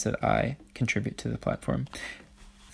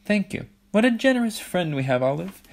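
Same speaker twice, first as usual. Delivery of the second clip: high, gushing mock gratitude; gloating Hattie; 8 semitones higher, 3 dB louder than usual. A narrator's reading. Hattie thanks Ella for the necklace, high and gushing. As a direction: gush it up high, pleased with winning.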